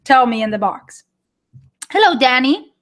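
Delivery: ordinary talk, nothing marked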